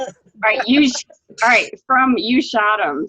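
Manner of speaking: loud, firm call to start again; loud call to restart. Real, not acted. Actor loudly calling to retake from a line.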